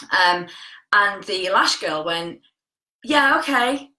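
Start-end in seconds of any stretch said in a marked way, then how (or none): 3.0-3.9 offhand, higher-pitched "yeah, okay"; noncommittal brush-off. Retold or imitated. Lash girl answering a client's coffee invitation, noncommittal. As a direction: toss off a polite, noncommittal agreement. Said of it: "That's how she said it".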